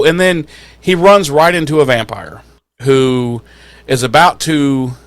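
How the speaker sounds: ordinary talk, nothing marked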